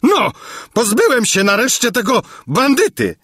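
high, gleeful cry of relief; joyful exclamation. A narrator's reading. King Padella rid of the bandit, joyful cry. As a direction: cry out, delighted to be rid of him. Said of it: "wykrzyknął radośnie".

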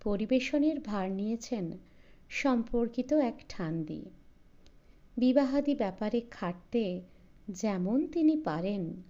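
ordinary delivery

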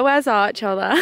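ordinary talk

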